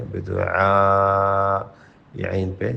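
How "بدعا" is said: بدعا with the ain sound stretched slowly; long ain. Shown as a demonstration. Teacher showing the long ain in بدعا. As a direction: hold the aa after the ain in بدعا. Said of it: "عین کو لمبا کریں".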